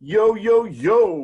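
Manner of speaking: loud, high whooped hello; exuberant greeting. Real, not acted. Guest joins the call and greets the hosts, exuberantly. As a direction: burst in loudly with high energy.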